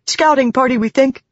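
high, winded messenger; urgent, high-pitched, out of breath; panting. A narrator's reading. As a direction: add the detail while catching your breath.

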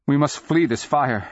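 high, loud call to flee; urgent. A narrator's reading. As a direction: urge them to get out now. Woman urges escape from fire.

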